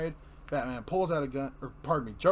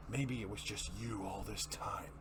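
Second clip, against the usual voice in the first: soft, breathy villain whisper; menacing whisper; 5 semitones lower, 8 dB quieter than usual. Acted. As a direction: whisper it like a secret threat. Joker's taunt ends in whisper.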